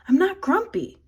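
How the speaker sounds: a touch of grumpy snap; lightly imitated grumpy retort. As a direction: say it crossly, mildly mock. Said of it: "in a pretty grumpy way".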